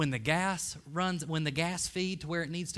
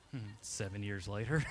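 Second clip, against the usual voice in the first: normal talking voice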